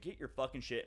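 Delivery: ordinary talk, nothing marked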